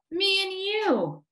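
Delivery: put-on high, happy child tone; little-boy voice. A narrator's reading. Eddie to his new friend, in little-boy voice. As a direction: declare it high and simply.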